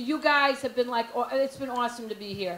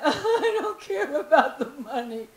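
slow, hammed-up lovestruck swoon; mock madly in love; slower than usual. Woman madly in love with a man, mock swooning. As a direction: draw it out like a besotted fool. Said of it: "If I'm madly in love with him".

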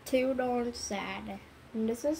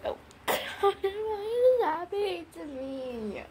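tearful wail; 7 semitones higher than usual. Pretend. Wailing over the sad story.

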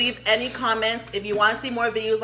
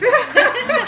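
laughing; 12 semitones higher, 7 dB louder than usual. Real, not acted. Joking about tattooed eyebrows.

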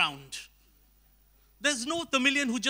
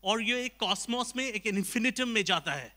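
fast, soft, meek Hindi explanation; Einstein as Hindi speaker; 2 semitones lower, 7 dB quieter, faster than usual. Acted. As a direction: rattle off a humble theory quietly. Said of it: "Albert Einstein could have said".

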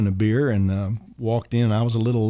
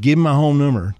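loud, higher-pitched order; 5 semitones higher, 6 dB louder than usual. Retold or imitated. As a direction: imitate the star insisting to his aide. Elvis insists on the order.

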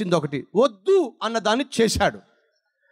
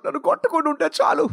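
put-on higher, fast, anxious child's plea; imitating a scared child; 6 semitones higher, faster than usual. Scared son hoping dad won't beat him, pleading fast. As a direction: hurry it out like a frightened boy. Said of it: "వాడికున్న భయం ఏమిటయ్యా అంటే".

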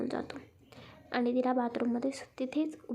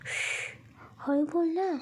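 a shush, then a higher mild scold; annoyed hushing; 4 semitones higher, slower than usual. A narrator's reading. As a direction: hush them with a touch of irritation. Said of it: "रागाने बघत बोलतो". (जरा रागाने गप्प करत)

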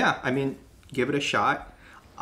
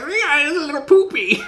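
very high, loud mock voice; hammed-up squeaky impression; imitating silly voice; 8 dB louder than usual. Host jokes about the little candies, squeaky mock voice. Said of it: "He's like".

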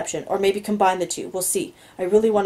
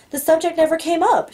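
lightly voiced young woman, higher; a touch higher for the heroine; Anna's voice; 9 semitones higher, 3 dB louder than usual. A narrator's reading. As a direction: narrator lifts into her voice. Anna voiced by male narrator.

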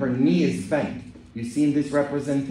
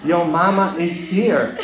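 put-on louder, higher playful Southern accent; 5 semitones higher, 6 dB louder than usual. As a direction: call it out in a mock Southern accent. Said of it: "That's my southern accent for you".

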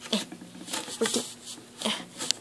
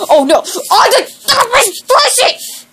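indignant denial; 7 semitones higher than usual. Acted.

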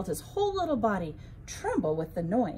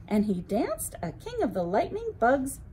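plain reading voice

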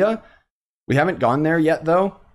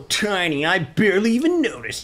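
raised-pitch, swaggering tease; mocking, higher-pitched character voice; cocky game character; 6 semitones higher than usual. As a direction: play a cocky big shot greeting someone tiny.

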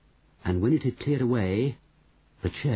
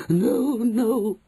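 louder higher desperate begging; 14 semitones higher, 5 dB louder than usual. Acted. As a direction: beg for mercy. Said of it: "begged the witch".

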